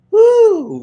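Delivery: a sudden high, loud woo of joy; excited whoop. Real, not acted. Singer remembering church background singing, excited whoop. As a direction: let out a big happy whoop. Joyful whoop recalling choir.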